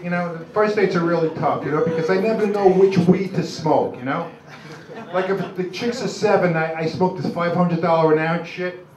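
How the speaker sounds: ordinary delivery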